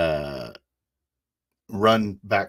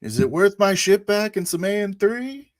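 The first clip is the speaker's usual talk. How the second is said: high, loud challenge; shouted bargaining; 13 semitones higher, 7 dB louder than usual. Acted. Pilot bargaining for his ship, shouted question. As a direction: shout it as an offer. Player bargains loudly with enemy.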